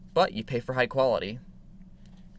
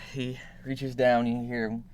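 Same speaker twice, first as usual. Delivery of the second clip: ordinary talk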